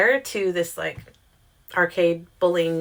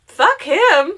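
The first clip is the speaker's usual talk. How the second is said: blunt outburst; 11 semitones higher, 8 dB louder than usual. Retold or imitated. Advising friend to drop guy.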